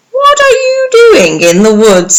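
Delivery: put-on sugary wolf tricking the girl; wolf putting on a sweet voice. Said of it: "he said sweetly".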